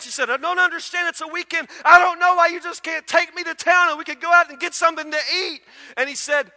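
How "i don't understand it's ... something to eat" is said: much higher and louder mock tirade; retold wife furious, way up and loud; mad wife. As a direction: she finally explodes at him. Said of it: "she was so mad".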